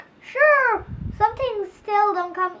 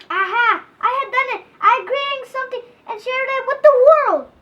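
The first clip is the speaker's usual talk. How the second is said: triumphant; 8 semitones higher, 7 dB louder than usual. Acted. Joy after praise.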